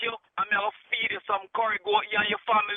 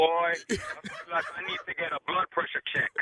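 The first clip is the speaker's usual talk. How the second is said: laughing; 6 semitones lower, 4 dB quieter than usual. Real, not acted. Relieved prank victim laughing it off.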